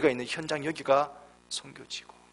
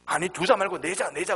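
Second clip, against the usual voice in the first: grandpa correcting; 7 semitones higher, 3 dB louder than usual. Retold or imitated. Grandpa corrects his wife.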